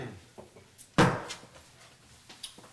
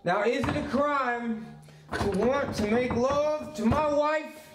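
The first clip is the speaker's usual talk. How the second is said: indignant protest; 8 semitones higher than usual. Acted. Drunk husband protesting to his locked-out wife, indignant and raised.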